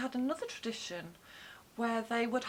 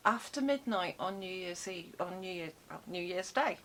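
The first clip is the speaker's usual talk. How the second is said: usual speaking voice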